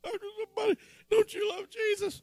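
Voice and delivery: high, wide-eyed pleading; horrified innocence. Retold or imitated. Preacher retelling being robbed, in a high naive voice. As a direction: squeak it like a shocked child. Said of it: "Instead, I was horrified".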